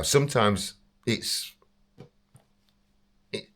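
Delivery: ordinary talk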